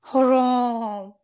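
stretched-out headmasterly harumph; elephant harumph. A narrator's reading. Elephant headmaster's trumpeting exclamation.

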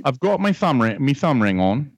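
hammed-up Australian accent; fake Australian accent. Prank caller posing as a visitor from Australia, fake Australian accent. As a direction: put on a shaky-quality amateur Australian accent. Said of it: "you are the most shitty Australian person".

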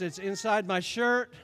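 ordinary talk, nothing marked

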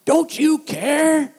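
high, loud, drawn-out mock yell; imitated angry yelling. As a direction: yell it like an irate parent on the phone. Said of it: "yelling at me".

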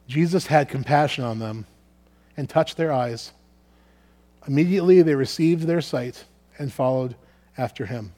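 usual speaking voice

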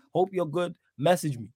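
ordinary delivery

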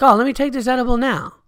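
high, loud goofy voice; high, loud hammed-up drunk self; drunk self-quote. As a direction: chirp it like a drunk dummy. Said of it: "I'm like".

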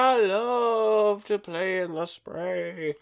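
high, giddy unhinged sing-song; madman voice. Retold or imitated. Carter hauled to the asylum, high giddy madman voice. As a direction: sing it like a lunatic in a straitjacket. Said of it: "he's just talking to himself".

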